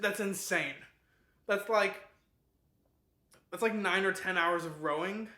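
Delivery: loud, disbelieving outburst; incredulous. Real, not acted. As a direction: react as if it's utterly insane. Reacting to an all-night row.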